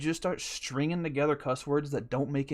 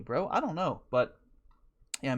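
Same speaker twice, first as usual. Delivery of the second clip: ordinary delivery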